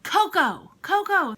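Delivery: son calling out, high and loud; child's excited call. Retold or imitated. Son pointing at a statue in the shop, excited call. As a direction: imitate a little boy's eager high call.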